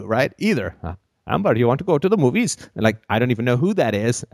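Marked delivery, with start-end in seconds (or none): 1.3-2.6 higher, faster put-on voice; quick odd voice asking again; a hint of a Klingon suitor. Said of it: "Klingon doesn't matter".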